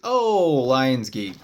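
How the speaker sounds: leaps high and loud on Oh, then lands; imitating the moment it clicks; surprised realization. Recognizing the Lionsgate portal in astral form, loud surprised exclamation.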